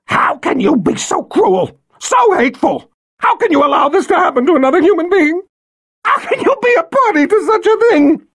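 high shrieking outrage; shrill accusation. Acted. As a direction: scream the accusation. Violet accusing Rachel.

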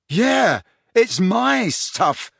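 high, drawn-out indignation; indignant realisation. A narrator's reading. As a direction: protest slowly as it sinks in. Harry realises the stolen things are his.

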